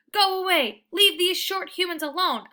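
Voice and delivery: high, loud snarling warning; fierce bark. A narrator's reading. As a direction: bark with teeth bared. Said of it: "I bark, baring my teeth".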